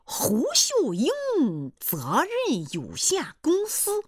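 slow, high, solemn announcement; straight-faced. Acted. Suitor repeats his company name, straight-faced. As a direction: stretch it out with mock gravity. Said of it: "他一脸正经地说".

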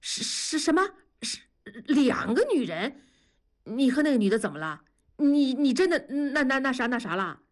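stunned disbelief. Acted. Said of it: "大瞪着眼睛问".

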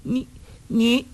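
high, feminine, anxious stammer; agitated young woman's protest; flustered. A narrator's reading. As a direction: stammer it out high, lost for words. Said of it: "程瑶家急道".

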